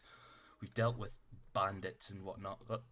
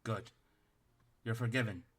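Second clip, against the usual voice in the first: a tiny officer barking orders at the party; stern little captain; 2 semitones higher, 4 dB louder than usual. Acted. Captain voice again.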